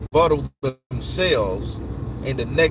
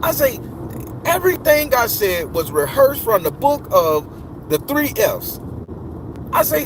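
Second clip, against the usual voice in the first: higher, louder, heated retold chewing-out; a raised, furious rant replaying the argument; worked-up tirade; 12 semitones higher, 4 dB louder than usual. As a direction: get more and more fired up telling her off. Retelling telling her off.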